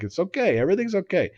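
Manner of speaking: dad calming his daughters in the car; soothing the kids. Retold or imitated. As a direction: settle the frightened kids gently. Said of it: "I'm like".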